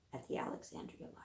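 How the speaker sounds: fast whisper; whispered aside. Real, not acted. Librarian mentioning the library quietly. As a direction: tail off in a whisper.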